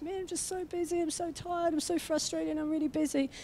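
imitating herself moaning to her life coach; mock whiny complaint. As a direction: whine about being busy. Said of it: "We were talking about busyness".

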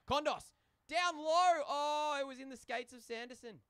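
loud, high shout easing off as the chance goes; excited play-by-play. Real, not acted. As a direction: burst out at the near goal, then settle. Calling a near goal live.

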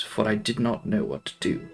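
ordinary delivery

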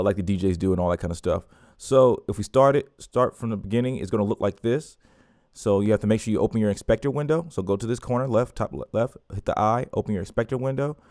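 ordinary talk

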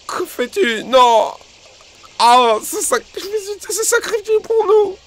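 very high-pitched melodramatic wail; hammed-up grief in a squealing high voice; mock-tragic hero. Player mourning Togedemaru's supposed sacrifice, mock-tragic.

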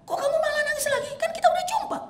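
very high, crying voice; tender questioning. Acted. Prophet asking the weeping Thauban, high and tearful. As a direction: ask him gently why he still weeps.

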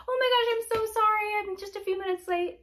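high, breezy apologetic client voice; nonchalant late client. Acted. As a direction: play the carefree client shrugging off being late. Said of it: "walks in nonchalant and says".

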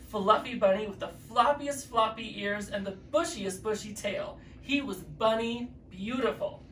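ordinary delivery, nothing marked

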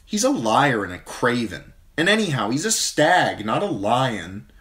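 scornful Arya. A narrator's reading. Younger sister scorning, lightly voiced. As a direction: sneer at the prince.

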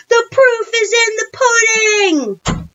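high, loud, stretched-out yell; shouting. A narrator's reading. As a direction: proclaim it at a triumphant shout. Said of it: "Michael shouted".

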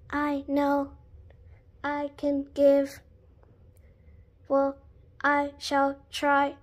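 low, monotone robot with gaps; halting robot speech on one note. Acted. Robot agrees to attempt a dinosaur roar, robot voice.

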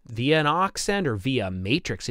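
normal talking voice

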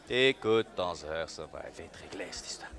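low menacing threat. Acted. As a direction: lean in and threaten him quietly. Catchphrase repeated.

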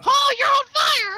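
high, loud startled cry; alarmed shout. Real, not acted. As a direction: yell in alarm as he burns. Friend on fire.

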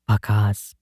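plain reading voice

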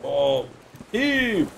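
high sea-shanty heave; heaving call. Pretend. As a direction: call out like hauling rope. Hauling the sails.

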